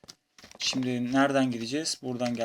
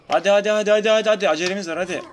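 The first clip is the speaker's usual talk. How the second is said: high, loud, rapid-fire urging; frantic loud hurry-up chant; hurried urging; 7 semitones higher, 10 dB louder, faster than usual. Real, not acted.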